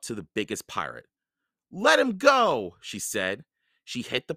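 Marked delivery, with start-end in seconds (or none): 1.7-2.8 high, loud, slow demand; defiant demand. A narrator's reading. Annie charges the biggest pirate, defiant. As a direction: demand release at raised pitch.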